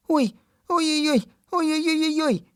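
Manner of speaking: clutch your head and wail ой; boy's alarmed cry. A narrator's reading. Ivas leaping from bed and crying out in alarm. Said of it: "як закричить".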